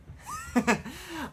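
high silly giggle; a goofy speechless giggle; Dopey giggle. Retold or imitated. Dopey giggling since he can't talk, high and silly. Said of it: "Dopey doesn't talk".

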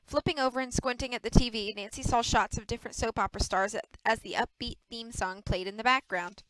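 ordinary delivery, nothing marked

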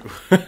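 high, loud laugh. Real, not acted. Co-host reacting to a generous super chat, laughing.

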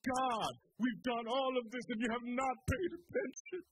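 a hammed-up grievance; mock complaint. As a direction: complain to God, aggrieved. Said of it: "They're saying".

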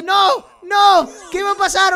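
high, loud cries of protest; alarmed shout. Acted. Gamer as Red's victim is told it's their turn, alarmed shout.

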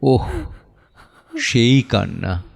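usual speaking voice

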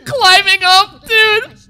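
high, loud, cracked-up wail; hysterical squeal. Real, not acted. Viewer pointing out a climbing character, hysterical squeal. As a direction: squeal it out, beside yourself.

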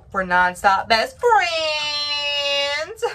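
high, slow, tearful wail; crying out. Real, not acted. Mom crying to her toddler about his leaving. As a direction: sob it out to her son.

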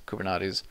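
"Kubernetes" said koo-ber-NAH-teez. Shown as a demonstration. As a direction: give "Kubernetes" an "ah" before the final -teez. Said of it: "whichever it is".

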